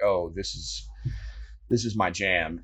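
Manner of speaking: low, laid-back retelling of her reaction; low mock-quote of a pleased co-host; loose co-host imitation. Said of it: "Linda doesn't speak like that".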